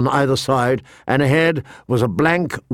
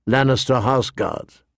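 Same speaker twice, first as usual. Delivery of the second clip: ordinary delivery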